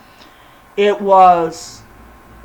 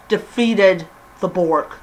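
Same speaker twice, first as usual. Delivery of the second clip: usual speaking voice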